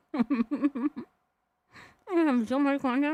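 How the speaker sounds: talking through a mouthful of food; mouth full. Real, not acted. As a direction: say it while chewing a bite. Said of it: "don't talk with your mouth so full".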